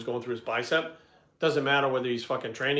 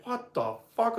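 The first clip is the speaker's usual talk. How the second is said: retold awe; 9 semitones higher, slower than usual. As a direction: imitate a buddy stunned by the gear. Acted awe at the DJ booth.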